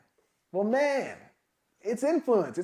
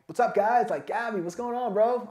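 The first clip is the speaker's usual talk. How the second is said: higher, upbeat hail to the guys; excited greeting; 5 semitones higher, 6 dB louder than usual. Retold or imitated. Calling up the team on a bad day, excited. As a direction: greet your buddies with an excited lift. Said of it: "I'm, like, excited again".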